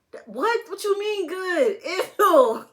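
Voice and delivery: high, loud, laughing disbelief; shocked disgust. Retold or imitated. Mom retelling her toddler tasting dog food, loud laughing disgust. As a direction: shriek in laughing horror at the answer.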